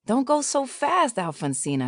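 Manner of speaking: put-on high calling out after a child; mock shout of worried parents. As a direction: call after her as she speeds off. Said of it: "her parents would scream".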